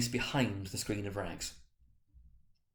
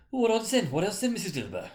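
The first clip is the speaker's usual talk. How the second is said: much higher, louder defiant voice; brazen woman; 10 semitones higher, 4 dB louder than usual. Acted. The charwoman dares her companions, brazen and high. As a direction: toss it out with bold defiance. The bold woman challenging the others.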